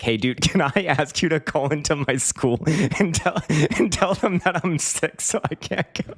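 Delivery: high-pitched quote broken by laughter; laughing retelling. Retold or imitated. Teen asking older online friend to phone school, laughing. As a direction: act out the request while cracking up.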